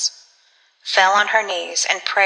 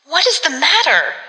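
high, swooping question; alarmed cry; 7 semitones higher than usual. A narrator's reading. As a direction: cry it out in alarm, pitch leaping up. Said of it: "she cried".

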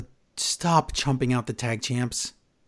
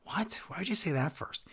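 hushed, incredulous aside; baffled whisper; 2 semitones higher, 12 dB quieter than usual. Real, not acted. Mocking Drew's promo to co-host, hushed baffled whisper. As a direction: whisper it in disbelief.

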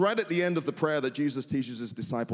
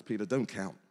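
softer, firm but kind; gentle insistence; 5 semitones lower, 5 dB quieter than usual. Retold or imitated. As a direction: quietly tell Peter to stop counting. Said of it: "Jesus stacks it up and says".

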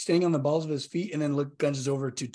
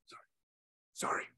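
very soft, small sorry; sheepish apology; 13 dB quieter than usual. Acted. Rebuked character apologising during the funeral, sheepishly hushed. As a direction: shrink back and barely voice it.

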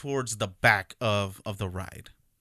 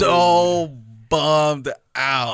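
emphatic dismay; 4 semitones higher, 8 dB louder, much slower than usual. Real, not acted. Sorry about not fitting.

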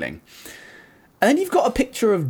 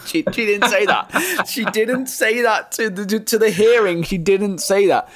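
higher, slow, laughing protest; incredulous laughing; 6 semitones higher, slower than usual. Real, not acted. Host mocking the widow's quote, slow and laughing. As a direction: drag out the disbelief through laughter. Laughing disbelief, stretching words.

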